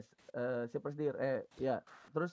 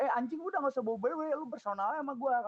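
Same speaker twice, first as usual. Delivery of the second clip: fast, higher angry retort; retold angry outburst; 11 semitones higher, 3 dB louder than usual. Storyteller snapping back at the thug, fast and higher. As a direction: fire it back fast and hot. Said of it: "kata gua".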